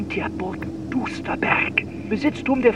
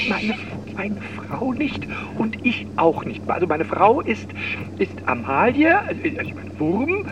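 ordinary talk, nothing marked

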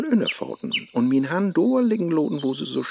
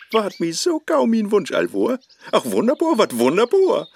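higher and a bit louder, gushing; eager flirting; 8 semitones higher, 3 dB louder than usual. Acted. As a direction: lay the charm on thick. Faust eagerly chats up a woman.

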